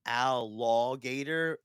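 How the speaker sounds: slow "Al Law Gator", each part apart; punny name. Retold or imitated. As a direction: spell out the pun, "Al Law Gator". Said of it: "and she said, like".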